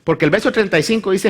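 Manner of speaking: ordinary talk, nothing marked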